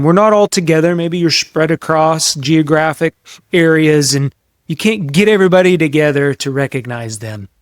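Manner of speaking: ordinary delivery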